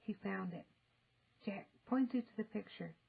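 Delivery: ordinary delivery, nothing marked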